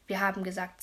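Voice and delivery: ordinary delivery, nothing marked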